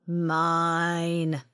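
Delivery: huge monster voice roaring one word; monstrous bellow. A narrator's reading. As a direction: bellow it like a beast. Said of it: "a monstrous voice bellowed".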